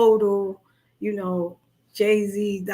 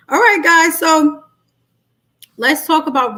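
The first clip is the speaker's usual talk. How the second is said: rallying call; 6 semitones higher, 9 dB louder than usual. Real, not acted. Rallying viewers to start.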